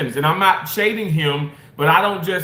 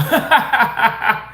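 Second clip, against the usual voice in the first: a hammed-up big laugh, pitched higher; imitated loud laugh; 6 semitones higher, 3 dB louder than usual. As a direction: mimic his booming laugh. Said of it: "he laughs loud".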